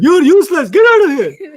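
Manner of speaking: loud, high scornful boss; angry firing. Retold or imitated. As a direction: bark him out of the office. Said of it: "he fired me, like".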